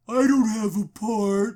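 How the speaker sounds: put-on slow, glum sulking kid; mopey Eeyore. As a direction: drag it out like a sad donkey. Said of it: "Eeyore, literally".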